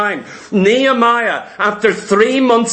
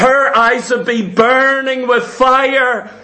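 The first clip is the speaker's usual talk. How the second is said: normal talking voice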